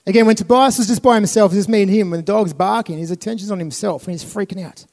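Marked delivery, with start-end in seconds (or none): none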